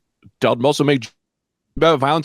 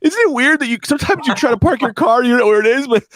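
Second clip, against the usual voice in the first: high, strained fake-tearful stand-up bit; trying not to cry; 11 semitones higher than usual. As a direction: tell the joke while choking back mock sobs. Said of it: "keep trying not to cry throughout my set".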